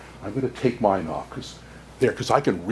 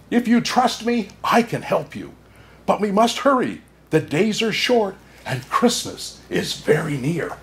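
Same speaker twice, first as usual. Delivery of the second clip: higher bird urging; little bird voice; 8 semitones higher than usual. Acted. Bird urging Anja to hurry, higher voice. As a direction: kindly press her to hurry.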